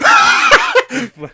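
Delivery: shrieking burst of laughter; game master laughing at the fire Genasi; loud high laugh. Real, not acted. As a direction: crack up hard at your own joke. GM laughing after outburst.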